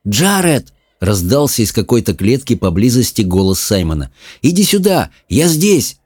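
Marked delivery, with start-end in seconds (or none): 0.1-0.7 higher calling shout. Acted. Simon calls his brother from a nearby cage. 4.4-6.0 higher calling shout. Acted. Simon calls his brother from a nearby cage.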